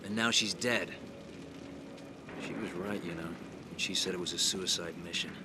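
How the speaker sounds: low, subdued and grieving; somber guilt. Acted. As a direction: speak low and heavy with regret. Guilt over a comrade's death.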